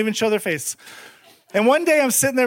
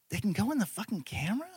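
hushed, fast disbelieving question; stunned hush; 3 semitones lower, 14 dB quieter, faster than usual. Retold or imitated. Realizing roaches hide inside his camera, hushed disbelief. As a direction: breathe it out in shock.

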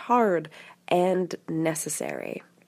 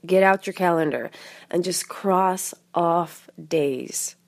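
usual speaking voice

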